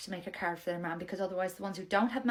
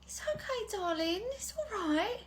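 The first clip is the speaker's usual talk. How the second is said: tender comfort; 12 semitones higher, slower than usual. Acted. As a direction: hush a crying toddler gently. Nursery worker comforts crying child.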